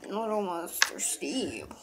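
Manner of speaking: slow, lightly voiced creepy monster; a hint of a spooky creature voice; Minecraft monster voice. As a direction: drag it out like a creepy game mob. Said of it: "that's not how he talks".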